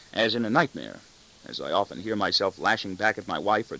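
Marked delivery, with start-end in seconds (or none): none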